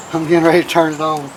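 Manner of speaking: ordinary delivery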